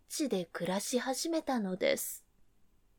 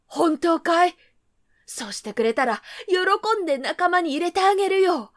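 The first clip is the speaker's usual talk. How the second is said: parent rabbits; 4 semitones higher, 7 dB louder than usual. Acted. Parent rabbits answer hopefully.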